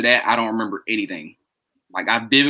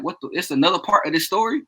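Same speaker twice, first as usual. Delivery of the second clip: retold disbelief; 7 semitones higher than usual. Retold disbelief at more story.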